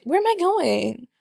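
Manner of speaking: high, lost question; mock confusion. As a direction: sound suddenly disoriented. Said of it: "you are like".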